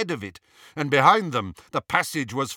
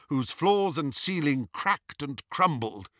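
ordinary delivery, nothing marked

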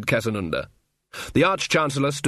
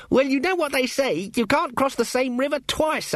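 much higher-pitched voice; earnest junior wizard; 13 semitones higher than usual. Acted. Ponder to the Arch-Chancellor at the bridge, earnest and high. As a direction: offer a saying in a high, earnest voice. Student wizard placating his senior with a saying.

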